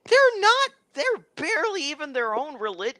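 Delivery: very high, strained outburst; high-pitched character voice, indignant; outspoken accuser. Acted. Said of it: "he says that out loud".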